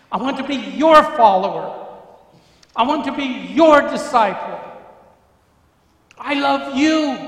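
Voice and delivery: high, loud, pleading commitment; fervent loud prayer of devotion; impassioned vow. Retold or imitated. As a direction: cry it out as a teenage boy giving his heart. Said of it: "And I say".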